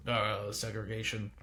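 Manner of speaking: low, soft mock mumble; half-swallowed caricature voice, dropped low; mumbled mimicry. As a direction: mumble it as a mocking impression.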